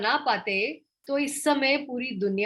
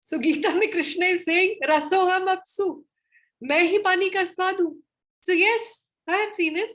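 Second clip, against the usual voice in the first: excited; 6 semitones higher, 3 dB louder, faster than usual. Real, not acted. Triumphant proof of seeing Krishna.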